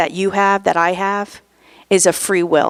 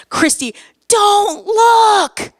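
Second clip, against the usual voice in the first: high, loud crying shout of a name; sister's frantic scream; 11 semitones higher, 3 dB louder, much slower than usual. Retold or imitated. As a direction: scream it in panic through tears. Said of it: "she screams".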